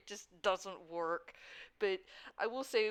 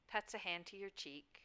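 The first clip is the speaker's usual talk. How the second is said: normal talking voice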